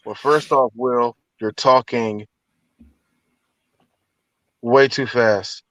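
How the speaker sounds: low, slow mock mentor. Actor coaching a younger one, slow low impression. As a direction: drag out the advice in a low voice.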